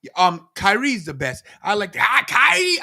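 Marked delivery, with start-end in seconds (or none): 2.0-2.8 high, loud mock cry of a name; sudden high-pitched mimicked yelp; imitated cry. Imitating Kairi's wrestling partner crying out.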